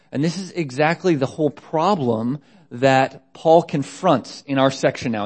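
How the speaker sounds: usual speaking voice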